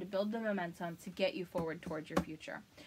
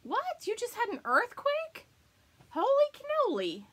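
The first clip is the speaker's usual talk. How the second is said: higher and louder, astonished; surprised; 14 semitones higher, 6 dB louder than usual. Real, not acted. To a chat viewer who had an earthquake, astonished. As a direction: gasp at the news.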